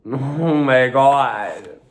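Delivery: slow, high, loud laughing gasp. Real, not acted. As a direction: laugh through the words slowly. Laughing in disbelief.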